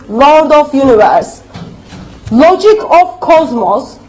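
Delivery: ordinary delivery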